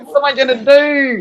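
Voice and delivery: mock a helpless plea; whiny mock plea. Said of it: "Tell 'em".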